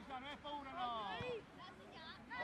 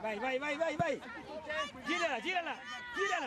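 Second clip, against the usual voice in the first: building shout; 2 semitones higher, 3 dB louder than usual. Real, not acted. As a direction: chant of vai vai rising to girala. Allenatrice incita durante l'azione. (incitamento crescente)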